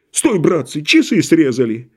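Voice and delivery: usual speaking voice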